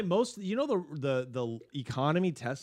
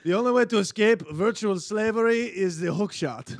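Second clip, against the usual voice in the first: put-on hack African accent; 6 semitones higher, 5 dB louder than usual. Voicing an African warlord.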